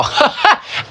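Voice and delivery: high airy laugh; a laugh pushed out on breath; disbelieving laugh; breathy laugh. Real, not acted. Laughs at her choice.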